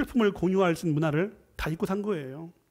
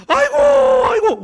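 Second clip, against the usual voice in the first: high, loud mourning wail; hammed-up Korean lament; 9 dB louder than usual. Shown as a demonstration. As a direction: cry out aigo to show grief. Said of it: "울어야죠".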